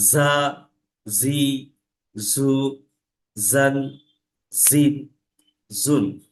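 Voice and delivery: জা জি জু জান জিন জুন, each clearly spelled out; syllable drill. Shown as a demonstration. Teacher drills vowel marks on জ for the class. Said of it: "কোরআনে কি পাবো".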